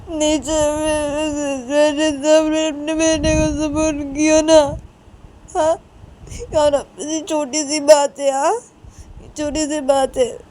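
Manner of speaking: loud, high, whining accusation; acted tearful tirade, words drawn out; hurt complaint. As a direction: pour out the grievance in a sustained wail. Upset Uma accuses the family.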